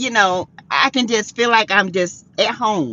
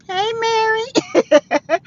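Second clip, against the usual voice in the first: sing-song greeting; 12 semitones higher, much slower than usual. Real, not acted.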